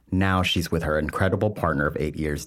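usual speaking voice

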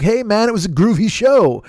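hippie talk. Acted. Circus lion tamer answering the crowd, hippie talk. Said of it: "he's doing fucking hippie talk".

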